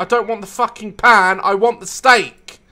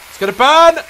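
panicked yell; 9 semitones higher, 8 dB louder, faster than usual. Real, not acted. Panicked cooking game.

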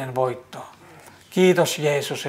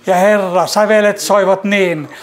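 raised, louder and higher, swelling praise; fervent praise; 4 semitones higher, 4 dB louder than usual. Real, not acted. Praying for the weary, rising into fervent, louder praise. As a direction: let the prayer swell into ringing praise.

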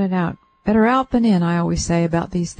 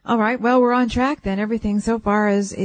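ordinary talk, nothing marked

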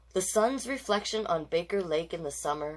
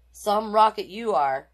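teasing jab, a bit higher and louder; mocking tease; 4 semitones higher, 8 dB louder than usual. Classmate mocking Ronald after his mistake, loud sneer. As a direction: needle him like a smug classmate.

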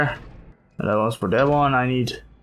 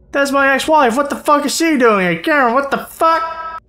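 much higher, louder exasperated yelling; mock outrage; 11 semitones higher, 5 dB louder than usual. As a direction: blow up at the ex-wife.

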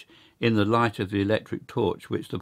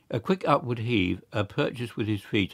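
ordinary delivery

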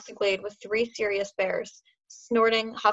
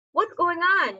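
high, louder, demanding question; 9 semitones higher, 4 dB louder, slower than usual. A narrator's reading. Hooded Roy demands to know what is happening, high and loud. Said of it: "he demanded".

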